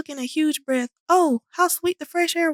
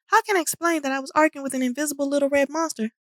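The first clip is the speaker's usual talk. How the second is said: ordinary delivery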